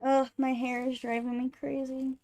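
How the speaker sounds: ordinary talk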